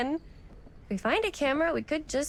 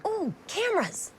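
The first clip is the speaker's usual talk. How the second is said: delighted ooh; 8 semitones higher than usual. Acted. Spots cameras in shop window.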